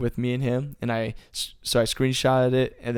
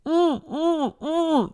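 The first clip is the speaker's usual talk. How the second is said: high-pitched humming groans, mimicking a dazed wrestler; imitated wordless moaning; much slower than usual. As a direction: moan three short, high, closed-mouth "mm"s. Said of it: "George did this".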